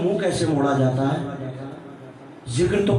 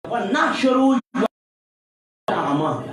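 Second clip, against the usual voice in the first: Quranic recitation; 3 semitones higher, slower than usual. Acted. Quoting the Quran verse.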